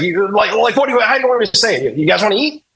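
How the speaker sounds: high, flustered outburst; said with emotion. Retold or imitated. Actor fed up with being directed, imitated emotional outburst. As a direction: throw the question back, high and worked up. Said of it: "because he said it with emotion".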